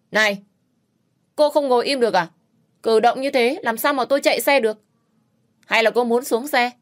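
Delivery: loud, high, barking at her to sit still; shouted scolding. A narrator's reading. Rider yells at the girl riding behind him, angry. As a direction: snap at the passenger from the motorbike. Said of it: "Anh ta quát".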